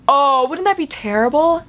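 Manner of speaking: normal talking voice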